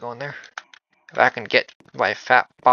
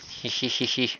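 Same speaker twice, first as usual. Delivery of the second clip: cartoon laugh; 7 dB quieter than usual. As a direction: giggle like a cartoon ghost. Creepy laugh after I see you.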